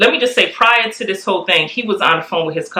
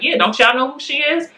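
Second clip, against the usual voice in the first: higher-pitched, indignant question; mildly mad; 9 semitones higher than usual. Retold or imitated. Wife imitating husband questioned by hospital staff, mildly mad. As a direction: throw it back at them, lightly indignant. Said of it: "He's, like, mad at them".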